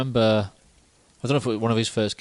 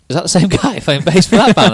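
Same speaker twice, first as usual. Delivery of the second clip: higher, louder incredulous question with a laugh; retold surprise; 9 semitones higher, 5 dB louder than usual. Recalling seeing him play in two bands, retold surprise. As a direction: replay your double take, pitched up. Acted reaction to spotting the bassist.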